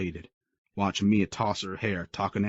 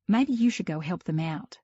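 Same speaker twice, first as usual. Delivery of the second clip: Mia, pointed; 10 semitones higher than usual. A narrator's reading. Mia telling Creed to leave her date alone, high and pointed. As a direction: shoo him away with pointed emphasis.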